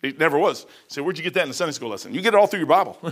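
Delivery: ordinary talk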